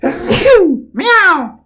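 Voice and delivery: high, loud cat meow after a cough. A narrator's reading. The cat Fiend meows with glee, loud and high. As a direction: yowl it like a gleeful cat.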